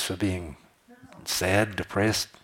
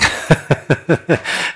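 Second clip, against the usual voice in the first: contagious belly laugh; 7 semitones higher, 7 dB louder than usual. Retold or imitated.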